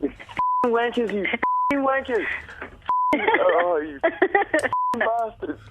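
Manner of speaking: high, cracking-up cursing at pranksters; mock-outraged, relieved swearing; real stunned relief. Real, not acted. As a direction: swear at them as the prank lands. Prank victim learns it's a radio prank.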